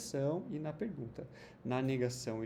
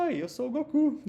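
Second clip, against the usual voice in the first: high, loud cartoon hero greeting; playful high loud anime greeting; Goku voice; 11 semitones higher, 8 dB louder than usual. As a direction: chirp it like an eager anime hero introducing himself. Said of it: "O Goku aparecia e falava assim".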